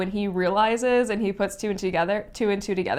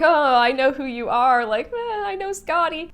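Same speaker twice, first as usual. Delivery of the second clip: higher, lightly acted awkward hello; mock awkward greeting; 6 semitones higher than usual. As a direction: play it as a cringey first meeting, lightly. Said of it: "and be like".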